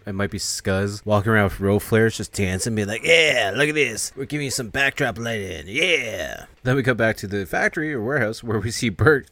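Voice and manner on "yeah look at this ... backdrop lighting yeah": drawn-out, put-on whooping voice; mock party cheer. Dancers showing off their flares, mock hyped and drawling.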